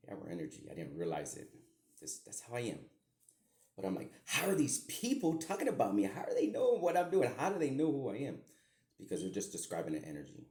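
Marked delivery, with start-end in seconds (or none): 4.2-8.4 high, loud, incredulous questions; re-enacted disbelief. Man recalling hearing strangers describe his life, incredulous. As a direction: fire off baffled questions in a raised, played-up voice. Acts out his past bewildered reaction.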